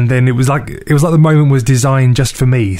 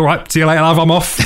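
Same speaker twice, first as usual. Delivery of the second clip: mock exit; 5 semitones higher than usual. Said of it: "I thought".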